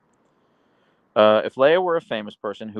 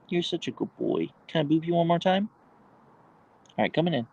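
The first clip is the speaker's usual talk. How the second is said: dog talk; 7 semitones higher than usual. Real, not acted. Cooing to his dog on stream.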